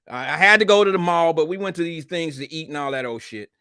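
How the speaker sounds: ordinary talk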